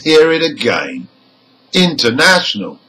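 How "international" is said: quick "International", main stress on NA, secondary on IN; primary NA, secondary IN. Shown as a demonstration. Teacher repeats the example for learners, "International" stressed on NA. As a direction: hit NA in "International", lightly stress the prefix IN. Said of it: "the secondary stress is on the prefix".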